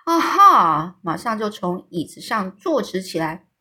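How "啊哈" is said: high, loud startled exclamation; sudden aha. A narrator's reading. Giant caught out by Sophie's question, with a loud aha. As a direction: jump upright with a loud aha. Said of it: "这友善的大巨人就叫了一声".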